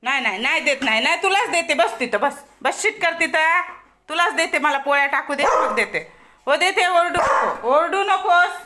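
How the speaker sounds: high, insistent calls over barking; loud placating. Real, not acted. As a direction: hush a clamouring dog, loud and high. Hushing the clamouring, barking dog.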